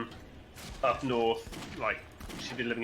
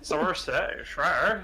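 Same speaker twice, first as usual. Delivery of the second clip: higher-pitched mock West Country accent; Somerset accent; 4 semitones higher, 3 dB louder, much slower than usual. Local joining the joke about home, hammed-up Somerset accent. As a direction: put on a jokey Somerset burr. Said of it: "everyone around there sounds like that".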